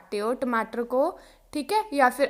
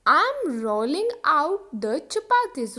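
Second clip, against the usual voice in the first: I am rolling out the chapatis, slowly; slow model sentence; much slower than usual. Shown as a demonstration. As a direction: drill it slowly for learners. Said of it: "example देखो". (धीरे-धीरे बोलें)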